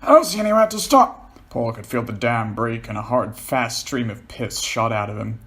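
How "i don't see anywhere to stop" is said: panicked. Acted. John panicking.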